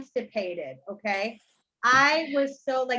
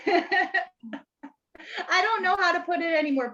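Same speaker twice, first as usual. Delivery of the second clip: laughing; 6 semitones higher, slower than usual. Real, not acted. Laugh heard.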